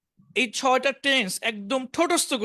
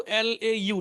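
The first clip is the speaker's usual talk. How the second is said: ordinary delivery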